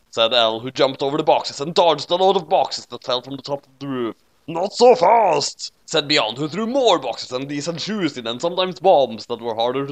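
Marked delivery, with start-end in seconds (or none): 4.5-5.7 high, slow loud taunt; loud villain taunt. A narrator's reading. Beyond mocks L's line, loud slow taunt. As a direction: hurl it back mockingly.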